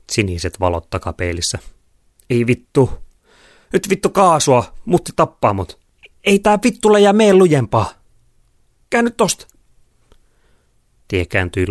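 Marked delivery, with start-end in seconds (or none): 2.3-9.5 high, loud panicked urging; frantic yelling. A narrator's reading. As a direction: scream at the driver to go faster. Car chase, police behind. (hätääntynyttä huutoa takaa-ajossa)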